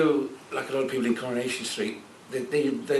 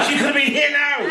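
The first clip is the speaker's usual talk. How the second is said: much higher, louder, laughing it out; laughing shout; 13 semitones higher, 7 dB louder than usual. Real, not acted. To the audience, laughing shout over the missed companion. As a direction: cry it out through laughter. Joking she'd be here now.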